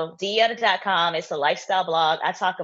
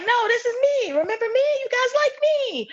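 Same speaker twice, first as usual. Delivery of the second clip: squeaky, pleading, much higher; whiny plea to be liked. Pretend. Pleading with followers, joking.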